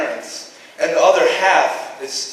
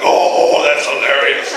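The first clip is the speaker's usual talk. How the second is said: mock amused; 4 semitones lower, 4 dB louder, slower than usual. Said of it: "are you gonna be like".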